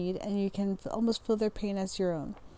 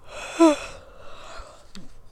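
sigh; 6 semitones higher, 3 dB louder than usual. Real, not acted. Frustrated her mouth won't work.

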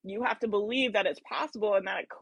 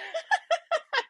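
a short, very high laugh; real amused laughter. Real, not acted. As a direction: laugh at how we all do this. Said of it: "I'm laughing 'cause we, like, all do this".

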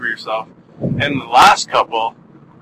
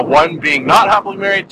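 usual speaking voice

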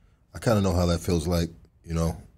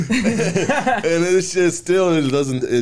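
laughing; 9 semitones higher, 8 dB louder, slower than usual. Real, not acted. Laughing at his own joke.

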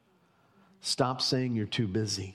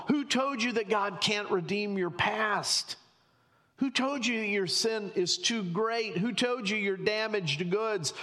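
impassioned preacher's challenge, pitched high; exposing the lie; 7 semitones higher than usual. Acted. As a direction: hammer each question to expose the liar, easing down at the end. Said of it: "he wants to expose the enemy".

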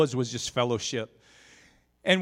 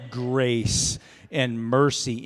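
slow self-calming; 4 dB louder, much slower than usual. Retold or imitated. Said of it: "and I'm like".

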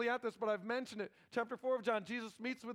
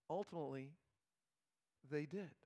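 softer solemn repeat; 5 semitones lower, 7 dB quieter than usual. Acted. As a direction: drop to a near hush for the repeat. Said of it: "And ultimately, they did".

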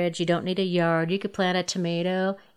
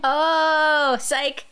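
surprised; 7 semitones higher, 5 dB louder than usual. Real, not acted. Reacting to the fooled worm.

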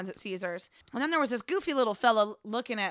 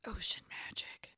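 whisper; 14 dB quieter, slower than usual. Pretend. Naming the slot machine mysteriously.

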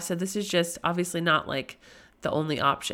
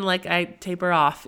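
normal talking voice

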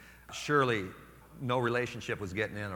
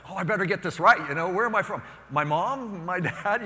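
high, loud, eager rush; flustered answer; 8 semitones higher, 9 dB louder than usual. Retold or imitated. Young priest answering mother eagerly, loud and high. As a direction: scramble to get it right. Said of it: "And I'm like".

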